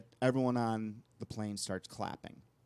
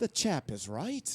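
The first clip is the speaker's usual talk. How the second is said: a slow, higher, mock Irish accent; 5 semitones higher, slower than usual. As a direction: drag it out like an Irish father agreeing. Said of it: "that wasn't that bad".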